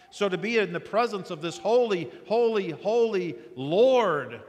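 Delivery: building emphasis. Real, not acted. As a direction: let it swell with awe.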